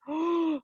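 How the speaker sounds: sharp storytelling gasp; mock shocked intake of breath; dramatic gasp. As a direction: gasp as if the tree is falling. Letters fall from the tree.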